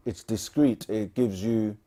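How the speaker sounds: normal talking voice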